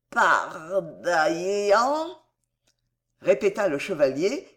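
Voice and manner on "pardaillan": very slow, drawn-out puzzled echo; straining to remember. A narrator's reading. Madman repeats a name he cannot place, slowly groping. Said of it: "qui paraissait faire des efforts de mémoire prodigieux".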